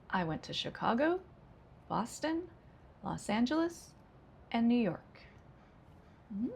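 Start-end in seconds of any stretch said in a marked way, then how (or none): 0.1-5.0 a list lifting on every item until "New York" drops; list intonation. Shown as a demonstration. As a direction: pitch climbs on "Chicago", "Boston", "Los Angeles", falls on "New York". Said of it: "Rising, rising, rising, falling. That's a list".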